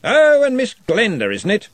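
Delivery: put-on cheerful old wizard; happily. A narrator's reading. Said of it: "said the Arch-Chancellor happily".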